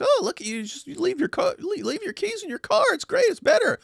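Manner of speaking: squeaky, very high mock enthusiasm; mocking impression. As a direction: parody a gushing admirer in falsetto. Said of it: "he was sitting there saying".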